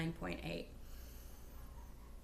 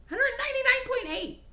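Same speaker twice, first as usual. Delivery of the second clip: excited squeal; 9 dB louder than usual. Real, not acted. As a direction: repeat the number high and loud with joy. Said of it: "and I am so excited".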